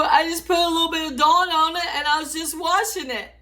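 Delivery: mock hysteria over the foam; retold panic. Telling mom the soap won't stop, in mock panic.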